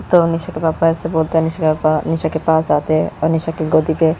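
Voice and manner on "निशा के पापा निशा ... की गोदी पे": plain reading voice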